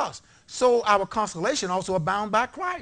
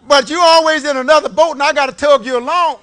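fiery preaching; 7 semitones higher, 12 dB louder than usual. Real, not acted. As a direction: preach it with full force. Preaching at full force.

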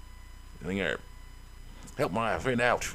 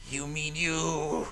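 slow, higher, stretched-out question; mocking character voice; 6 semitones higher, much slower than usual. Kid talking back to a bully, mocking drawl. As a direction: drag the words out in a put-on voice.

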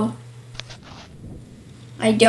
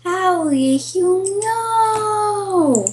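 slow, drawn-out, higher pitch; mock surprise; 6 semitones higher, much slower than usual. Playfully feigning surprise at the opponent's guess. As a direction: stretch it out in fake amazement.

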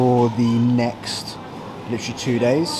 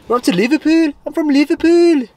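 very high, loud Scouse caricature; mock Liverpool accent; 6 dB louder than usual. Announcing the trip to Liverpool in loud, high-pitched mock Scouse. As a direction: squeal it in a put-on Scouse accent.

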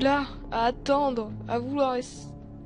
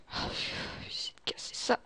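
soft whisper to oneself; whispered muttering; 7 dB quieter than usual. Acted. Escapee trying to break something, whispering. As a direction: whisper as you work.

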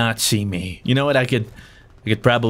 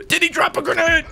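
alarmed. Real, not acted. Grenade surprise.

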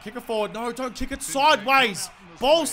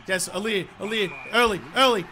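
ordinary talk, nothing marked

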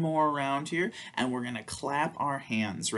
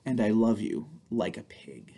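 slow, playful picture-book read; reading to children; slower than usual. Acted. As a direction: read it aloud to little ones with big expression. Reads picture book to kids.

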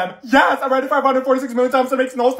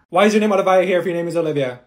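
ordinary talk, nothing marked

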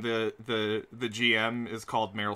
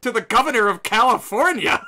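high, loud, impassioned rallying cry; mock rousing speech; 10 semitones higher, 9 dB louder than usual. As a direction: urge them on like a campaign speech. Rousing speech to Austrian friend.

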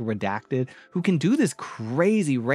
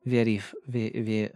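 halting fake French accent, slipping toward Russian; struggling French accent. Recalling a vampire character for viewers, struggling French accent. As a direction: put on a shaky-footed French accent, searching for words. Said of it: "let me see if I can even do it".

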